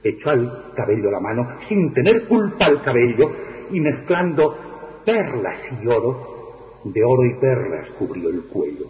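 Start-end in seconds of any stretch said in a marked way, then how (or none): none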